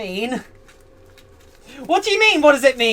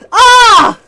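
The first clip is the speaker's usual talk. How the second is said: high shriek; 10 semitones higher, 12 dB louder than usual. Real, not acted. Excited shriek at chat.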